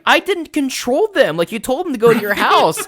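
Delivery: high, loud defensive protest; defensive yell. Retold or imitated. Player shouting back at friend, defensive. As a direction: shout back that it isn't your fault.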